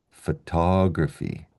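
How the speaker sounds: slow "photography" stressed on "tog"; "photography" drawn out, strong on its second syllable; third-last syllable stress. Shown as a demonstration. Teacher shows the shift, "photography" stressed third last. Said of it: "stress the third last syllable".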